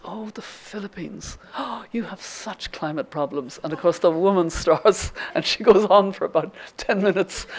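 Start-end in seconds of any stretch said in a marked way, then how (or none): 0.1-3.6 hushed, slow, heartfelt pity; imitating a friend's gentle, breath-held concern; sympathetic. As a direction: drop very soft and draw out the sympathy. Imitates Maeve sympathizing with the woman.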